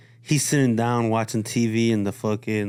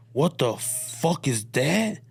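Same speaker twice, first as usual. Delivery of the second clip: angry; 5 semitones higher, slower than usual. Retold or imitated. Dad confronts son.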